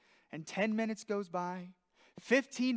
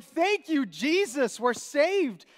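higher, louder relieved cry; lost hiker finding a compass, loud relief; 5 semitones higher, 8 dB louder, slower than usual. Retold or imitated. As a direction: burst out in relief.

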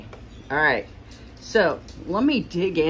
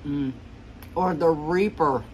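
chewing while talking; muffled through food; mouth full. Real, not acted. Seen 3: Cheeks puffed and chewing after bite taken at -2; fork in hand.

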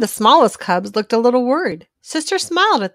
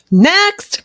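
high, loud call to the waiting room; doctor calling the next cub in, loud and high; 9 semitones higher, 7 dB louder than usual. A narrator's reading. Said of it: "called Doctor Grizzly".

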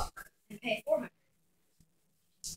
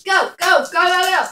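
excited shouting; 4 semitones higher, 10 dB louder than usual. Real, not acted. Cheering on a dice roll.

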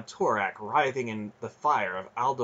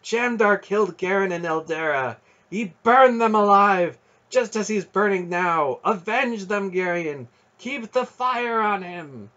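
high-pitched, ringing demand for vengeance; impassioned urging; 9 semitones higher, 6 dB louder than usual. A narrator's reading. Aunt urging the boy to avenge his parents, fierce. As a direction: incite him fiercely, pitch held high.

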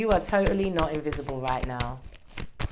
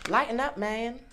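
cards' message; 6 semitones higher than usual. Pretend. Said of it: "It's saying lighten up".

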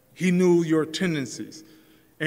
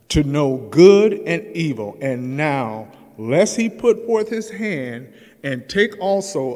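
preaching scripture; 7 dB louder than usual. Acted. Preacher reads Genesis to the congregation, booming. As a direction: proclaim it from the pulpit.

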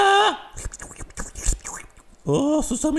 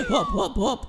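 ordinary delivery

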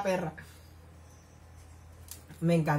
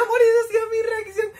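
high, louder excited gush; gushing squeal; 13 semitones higher, 5 dB louder than usual. Real, not acted. Vlogger telling viewers her reaction, high giddy gush.

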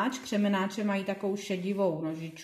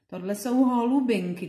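usual speaking voice

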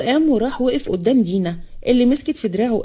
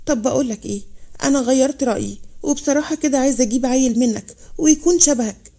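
ordinary delivery, nothing marked

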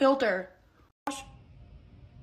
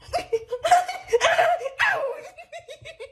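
long high laughing fit; helpless laughter; cracking up; 14 semitones higher than usual. Real, not acted. Laughing at the mess.

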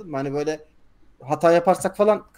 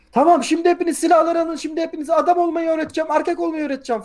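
high-pitched drill commander rant, raised voice; bağıran komutan taklidi; 12 semitones higher than usual. Retold or imitated. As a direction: imitate a commander yelling at recruits. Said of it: "komutan bağırıyor". (tiz sesle bağıran komutan)

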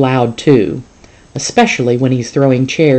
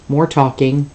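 plain reading voice

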